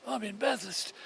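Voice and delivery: lightly voiced, high-pitched owner on the phone; a hint of the sick owner; owner's voice. Owner on the phone telling where he is, lightly voiced high.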